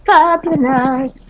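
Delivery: slow, sassy and high; drawn-out diva flourish; fabulous. Acted. Character's fabulous transformation line.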